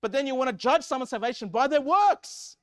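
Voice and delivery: loud, high, indignant preacher; forceful preaching. Real, not acted. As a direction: drive the argument home at full voice.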